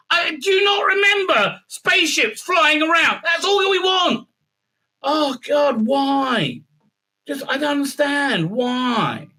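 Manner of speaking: high-pitched, pleading fan rant; exasperated lament. Real, not acted. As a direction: wail it like a let-down fan. Fan laments lack of spaceships.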